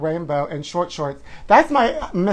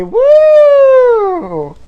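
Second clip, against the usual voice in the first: very high, loud party whoop; shrieked pride cheer; 11 dB louder than usual. Real, not acted. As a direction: cheer it like a parade float. Cheering Happy Pride sign-off.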